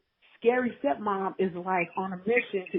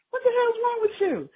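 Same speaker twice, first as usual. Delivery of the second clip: much higher and louder, indignant cry; outraged stepmom shriek; 13 semitones higher, 4 dB louder than usual. Retold or imitated. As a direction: shriek it at the girlfriend in disbelief. Stepmom outraged at mind game.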